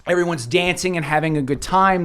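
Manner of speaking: ordinary delivery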